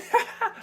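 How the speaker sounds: breathy delighted laugh; happy laugh. Real, not acted. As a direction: laugh with joy. Laughing after whoop.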